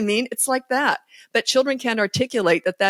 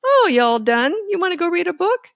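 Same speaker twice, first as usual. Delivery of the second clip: bright, higher, upbeat parent; breezy parent; 11 semitones higher than usual. Retold or imitated. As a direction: cheerfully move on, no fuss. Parent voiced after tantrum.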